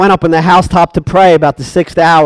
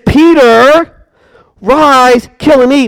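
higher, commanding voice from heaven; heavenly command; 6 semitones higher, slower than usual. Acted. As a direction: call it down from above.

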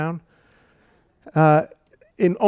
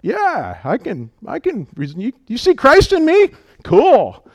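playful believer; 7 semitones higher, 5 dB louder than usual. Retold or imitated. Voicing believers' reply.

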